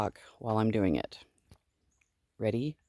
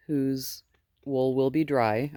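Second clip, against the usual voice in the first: ordinary delivery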